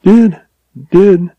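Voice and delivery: high, halting broken voice. A narrator's reading. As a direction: stammer it out, voice breaking. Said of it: "she whispered brokenly".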